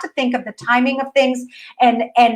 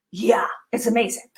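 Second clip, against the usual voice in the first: whispered aside; 6 dB quieter than usual. Real, not acted. Soft aside.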